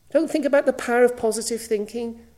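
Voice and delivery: ordinary talk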